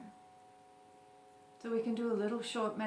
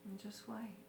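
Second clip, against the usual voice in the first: guided meditation; 7 dB quieter than usual. Acted. Teacher leading listeners through a short breathing meditation, calm guided meditation. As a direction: gently guide them into stillness, unhurried.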